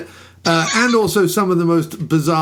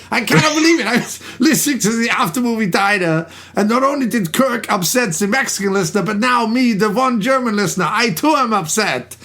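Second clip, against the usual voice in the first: a hammed-up German accent, pitched up; mock German accent, raised pitch; 3 semitones higher than usual. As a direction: play the upset German fan in a bad German accent. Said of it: "he does this, uh, atrocious accent".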